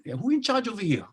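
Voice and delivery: casual Pidgin question pitched well up; Pidgin example question. Shown as a demonstration. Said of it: "We say".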